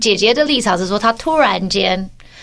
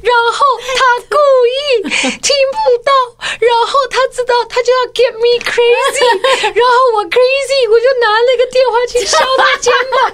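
put-on high, crying child's voice, much higher; whiny sobbing kid pleading her case; tearful little girl; 12 semitones higher than usual. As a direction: sob it out as the wronged little sister. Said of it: "崩泪这样子说".

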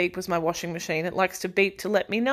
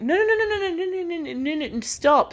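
a frantic higher then settling no, no, no; panicked no; 9 semitones higher than usual. Retold or imitated. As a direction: stop her in a rising panic. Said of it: "me and Mum going".

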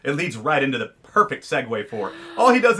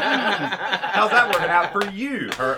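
sassy mock jab; 6 semitones higher, 3 dB louder than usual.

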